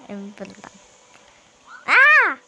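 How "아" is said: startled scream. Real, not acted. Sudden shout during game.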